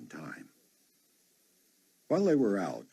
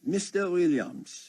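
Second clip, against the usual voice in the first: put-on slow, thick French accent greeting; lightly voiced French accent, drawn out; 13 semitones higher, 4 dB louder, slower than usual. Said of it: "he said in a thick French accent".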